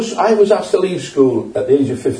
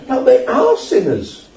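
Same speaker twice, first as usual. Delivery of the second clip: higher pitched, pressing the point hard; raised preaching voice; 7 semitones higher than usual. Real, not acted. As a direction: preach it up, nearly shouting. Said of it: "Am I shouting?".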